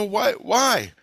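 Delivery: put-on dead kid railing to friends, high and pained; anguished complaint. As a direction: cry out at the pointlessness. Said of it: "I go".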